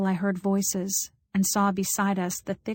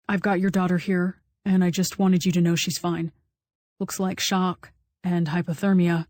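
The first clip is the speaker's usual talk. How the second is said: lightly voiced firefighter. A narrator's reading. Firefighter on the phone.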